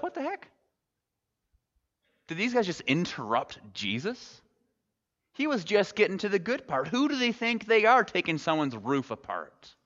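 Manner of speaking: high-pitched outraged disbelief; indignant onlooker. Retold or imitated. Preacher voicing a crowd annoyed at the interruption, high and indignant. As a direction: sputter like a crowd member cut off. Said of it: "we're like".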